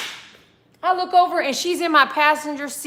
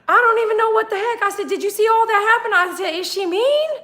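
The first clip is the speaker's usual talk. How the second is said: high, fast and louder; excited rapid telling; 9 semitones higher, 4 dB louder than usual. Retold or imitated. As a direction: spill it out excitedly to the lady. Excited asking lady at pump.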